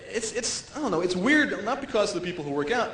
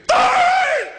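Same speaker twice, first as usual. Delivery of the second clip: very high, loud meathead yell; steroid-speak; 9 dB louder than usual. Acted. Answering the gym guy in steroid language, roared. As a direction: blurt it as one musclehead roar. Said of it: "or in steroid".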